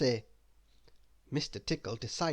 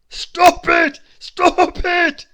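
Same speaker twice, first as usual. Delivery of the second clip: high, loud pleading dragon; tickled dragon begging for mercy; desperate loud cries; dragon crying out; 16 dB louder, much slower than usual. Acted. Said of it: "he cried".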